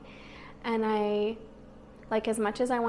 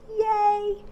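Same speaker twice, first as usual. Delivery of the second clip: high, bright little cheer; excited cheer; 11 semitones higher, 6 dB louder than usual. Real, not acted. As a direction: cheer it out happily. Celebrating getting monetized.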